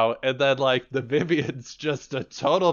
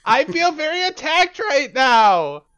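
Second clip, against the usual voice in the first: much higher and louder mock wail; hammed-up high shout of offense; higher register; 8 dB louder than usual. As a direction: shriek it like a wounded diva. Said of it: "in higher and higher registers".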